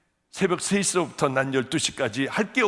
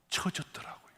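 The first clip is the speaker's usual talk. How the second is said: whisper; 9 dB quieter than usual. Retold or imitated. Hushed telling of shrunken legs.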